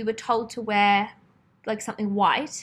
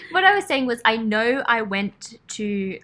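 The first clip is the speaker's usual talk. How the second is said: ordinary talk, nothing marked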